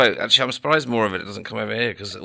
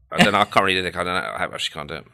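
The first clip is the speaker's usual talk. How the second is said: ordinary talk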